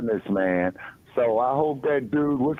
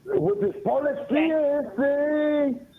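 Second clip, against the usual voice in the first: high mock Mexican accent, slowly; playful Chicano street voice; 14 semitones higher, slower than usual. Mock Spanish accent bit.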